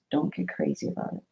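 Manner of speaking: quick hushed whisper. Real, not acted. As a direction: drop to a fast whisper. Quiet aside ending her rant.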